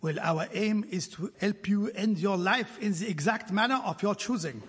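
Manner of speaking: ordinary delivery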